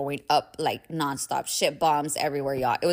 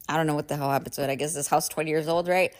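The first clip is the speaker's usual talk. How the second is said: ordinary talk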